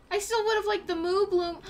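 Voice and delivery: normal talking voice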